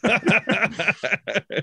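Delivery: big helpless burst of laughter. Real, not acted. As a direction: crack up at your own joke. Laughing at own joke.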